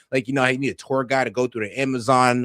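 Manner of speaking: ordinary delivery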